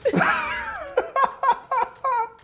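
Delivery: high, loud cackling laugh; shrieking laugh. Real, not acted. Confessing she lied, a loud shrieking laugh. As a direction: burst out laughing. Laughing at her own lie.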